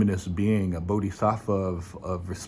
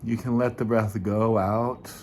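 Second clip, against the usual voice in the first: guided meditation; 5 dB quieter than usual. Acted. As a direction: ease listeners gently into the breath. Leads a meditation.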